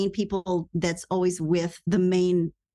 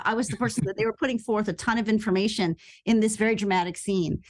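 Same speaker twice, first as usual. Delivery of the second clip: usual speaking voice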